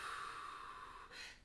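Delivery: heavy breath out, whispered; audible letting-go sigh; long slow exhale. Shown as a demonstration. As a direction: demonstrate breathing it all out. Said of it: "when you breathe out".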